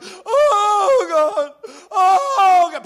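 imitating anguished wailing; long, high, loud cries of travail; loud wailing moan. Students weeping in chapel, imitated wailing. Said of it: "People are moaning".

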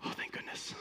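breathy whispered relief; relieved whisper. Retold or imitated. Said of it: "you go".

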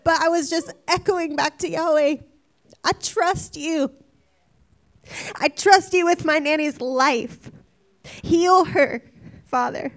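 high, loud, slow sobbing words; ugly crying. Real, not acted. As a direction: cry it out, voice breaking. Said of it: "I'm gonna ugly cry through the whole thing".

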